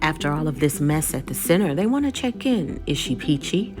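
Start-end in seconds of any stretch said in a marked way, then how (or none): none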